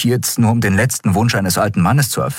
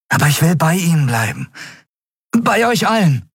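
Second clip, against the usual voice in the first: high, bright-eyed plea to come along; eager young Miko; 12 semitones higher than usual. Acted. Miko asking the captain to come along, high eager voice. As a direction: ask to join like a thrilled youngster.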